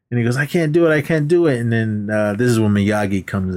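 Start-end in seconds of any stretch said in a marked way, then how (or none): 0.4-1.6 high-pitched retold protest; imitated anxious objection, higher; mock protest. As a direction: act out Daniel balking, voice pitched up. Voices Daniel protesting the bet.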